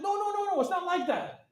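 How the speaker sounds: high, rushed no no no; frantic denial. Retold or imitated. Retold to a gang friend, a high frantic denial. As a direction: hurry to wave off the mix-up.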